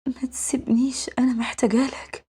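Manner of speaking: soft, whispery plea as if dreaming; feigned nightmare. Pretend. Girl faking a nightmare to draw her guardian close, whispery pleading. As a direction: plead faintly, pretending to talk in your sleep. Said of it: "فبتمثل إنها بتكوبس".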